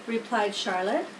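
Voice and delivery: usual speaking voice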